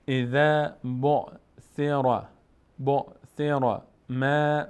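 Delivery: slow, drawn-out chanted Arabic recitation; slow Quran recitation. Shown as a demonstration. Teacher slowly reciting the verse for the student, chanted. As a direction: stretch every syllable as in tajweed. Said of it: "teacher recites".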